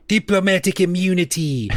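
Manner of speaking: high-pitched mock South African accent. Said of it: "say in South African accent".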